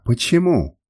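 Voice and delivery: ordinary delivery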